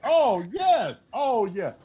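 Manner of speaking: very high-pitched, drawn-out delight; gleeful relish. Acted. Co-host egging on the podcaster's glee, delighted. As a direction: savor it in a high, playful voice.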